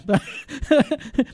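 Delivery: a big real laugh breaking out; hearty laugh. Real, not acted. Host laughing at his own preaching joke, hearty laugh.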